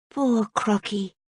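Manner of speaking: slow, soft and tender; gently. Acted. Cora gently soothes the crocodile, slow. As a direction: comfort a crying pet. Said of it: "she said gently".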